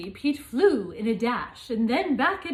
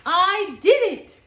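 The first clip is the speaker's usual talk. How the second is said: triumphant; 7 semitones higher, 9 dB louder than usual. Acted. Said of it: "said Pete".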